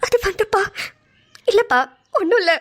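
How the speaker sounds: choked, broken-up reluctance; tearful hesitation. Acted. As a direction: start to confess, then back off tearfully. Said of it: "ஏன் அழுதுட்டு இருக்கீங்க". (அழுகையோடு சொல்லத் தயங்கி)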